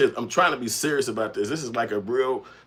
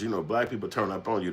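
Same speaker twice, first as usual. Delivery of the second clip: ordinary talk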